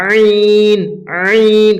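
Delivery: আইন drawn out from a squeezed throat. Shown as a demonstration. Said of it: "হালাক থেকে বের করতে হবে". (গলা চেপে ভিতর থেকে আইন)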